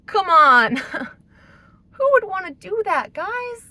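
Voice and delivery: laughing in disbelief, high and loud; exasperated laugh. Real, not acted. Laughing at absurd investigation.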